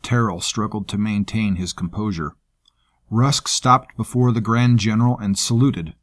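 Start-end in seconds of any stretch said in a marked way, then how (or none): none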